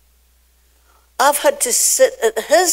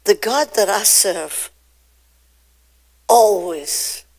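normal talking voice

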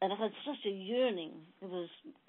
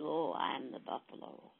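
low, slow dying buffalo voice; weak buffalo; 5 semitones lower, 4 dB quieter than usual. A narrator's reading. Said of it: "He said".

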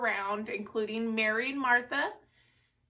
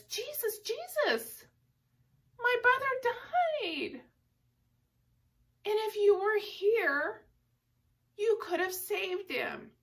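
much higher, pleading grief-stricken voice; upset Martha; 10 semitones higher than usual. Retold or imitated. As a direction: cry out to Jesus as a grieving sister. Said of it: "And so she was upset".

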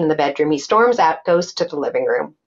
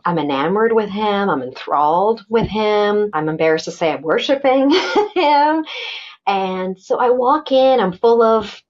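usual speaking voice

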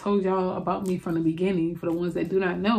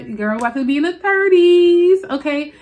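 excited hype; 6 semitones higher, 6 dB louder than usual. Real, not acted. Hyped about turning thirty.

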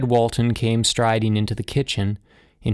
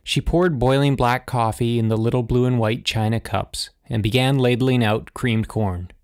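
plain reading voice

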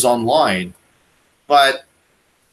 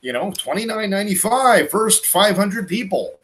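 higher-pitched, drawn-out TV sales pitch; infomercial pitchman; 8 semitones higher than usual. Acted. Host hawking a product on a shopping channel, infomercial pitch. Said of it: "infomercials. Like, literally, half the videos".

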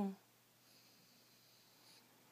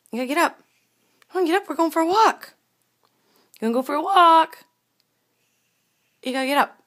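loud, higher, excited walk call; rousing the dog; 5 semitones higher, 11 dB louder, faster than usual. Real, not acted.

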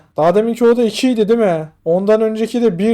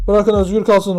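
ordinary talk

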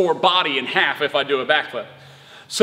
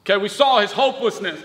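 usual speaking voice

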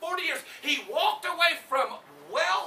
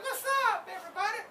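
much higher cheerful crowd greeting; Aaron's hype greeting; 9 semitones higher, 3 dB quieter, faster than usual. Acted. Preacher voicing Aaron greeting everybody. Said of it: "He's like".

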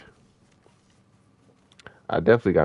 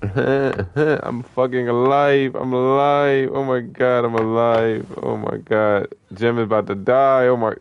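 high, drawn-out excited relief; elated disbelief; 5 semitones higher, 3 dB louder, slower than usual. Real, not acted. Streamer finding they survived the sniper, elated disbelief. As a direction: exclaim as if you just escaped death. Surviving a near death in game.

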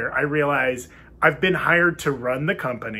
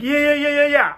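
eager yeses; 10 semitones higher, 5 dB louder than usual. Retold or imitated. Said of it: "I'm just like".

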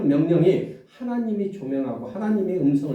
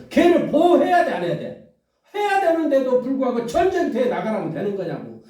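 raised, urgent preaching to the congregation; fiery preaching; 9 semitones higher, 3 dB louder than usual. Acted. Pastor pressing the congregation about David, urgent preaching. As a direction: press the question home from the pulpit.

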